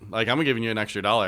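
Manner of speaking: ordinary talk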